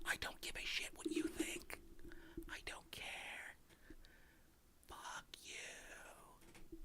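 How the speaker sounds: unvoiced whisper, very soft; a mock-intimate whispered brush-off; whispered retort. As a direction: hiss it as a secret, slowly. Whispered retort to concern trollers.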